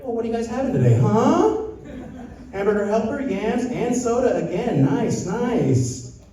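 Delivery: creepy neighbor-watcher voice. Retold or imitated. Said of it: "I'd be like".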